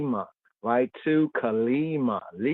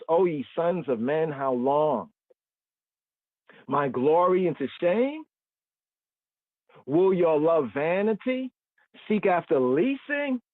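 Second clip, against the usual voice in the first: raised, higher solemn reading of the psalm; scripture reading; 4 semitones higher than usual. Acted. Teacher reading the psalm aloud to his class, higher solemn reading voice. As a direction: read the verse out like scripture, lifted and solemn.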